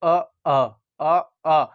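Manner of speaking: अ pushed low from the throat; a low throaty अ, hand on the throat; throat sound demo. Shown as a demonstration. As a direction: voice अ deep in the throat so it buzzes. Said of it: "गले में हाथ रखिए".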